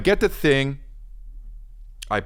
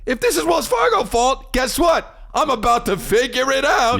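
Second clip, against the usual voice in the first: mounting outrage. Real, not acted. Rising frustration at declined card.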